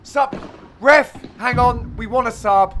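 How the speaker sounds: loud, jeering call to the ref; mock demand for a sub. Heckling the opponent mid-match, loud mock call for a sub. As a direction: shout him off the court like a fed-up teammate. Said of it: "we go".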